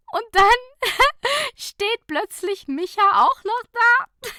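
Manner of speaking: very high, loud words through laughter; laughing hard. A narrator's reading. Fabienne laughing with Nicola in the car, giggling squeals. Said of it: "lachte Fabienne".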